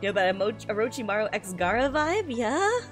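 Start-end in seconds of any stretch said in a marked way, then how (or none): none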